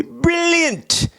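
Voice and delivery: high, hammed-up British "Brilliant!"; mock British. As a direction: toss off a cartoon British "Brilliant!". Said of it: "What do you guys say?".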